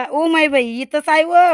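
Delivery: startled alarmed cry. Real, not acted. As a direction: yelp as the snake appears close by. Snake suddenly right there. (घाबरून मोठ्याने ओरडणे)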